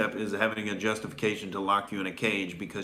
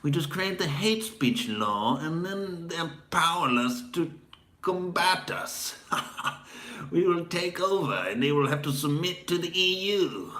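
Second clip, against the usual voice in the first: high, hammed-up British villain with evil laugh; haughty British tyrant; 7 semitones higher than usual. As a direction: gloat grandly like a British tyrant seizing power. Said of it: "That's my haughty British tyrant character".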